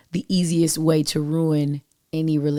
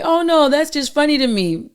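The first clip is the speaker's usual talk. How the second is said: higher-pitched mock brush-off; put-on "just joking" defense, pitched up; mimicking dismissive people; 9 semitones higher, 4 dB louder than usual. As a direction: imitate someone lightly waving it off in a raised pitch. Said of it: "I know some people are like".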